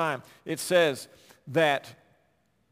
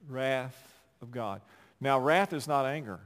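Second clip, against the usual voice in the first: normal talking voice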